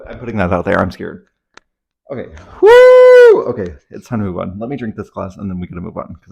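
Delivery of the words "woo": sudden high shout to reset; a big release whoop; loud whoop. Real, not acted. Shaking off fear with a whoop.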